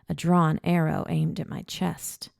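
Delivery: ordinary delivery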